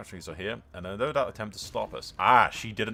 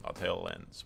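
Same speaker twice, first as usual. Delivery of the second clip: usual speaking voice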